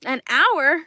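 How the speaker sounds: disbelieving high, loud question; retold shocked disbelief; incredulous. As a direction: react like it's outrageous. Retelling coworkers' hour-long break.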